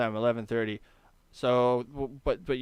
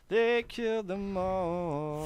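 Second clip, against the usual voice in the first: slow dramatic; 7 semitones higher, much slower than usual. Acted.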